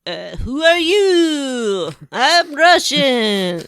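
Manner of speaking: put-on over-the-top video game Russian thug accent; bad Russian accent. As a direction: ham up a cheesy Russian accent, drawing every word out. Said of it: "The accents aren't very good in this game".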